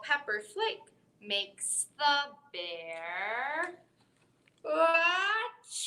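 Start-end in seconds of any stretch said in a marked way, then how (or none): 4.6-5.9 acted achoo sneeze, higher; a big sneeze sound. Bear sneezes in story.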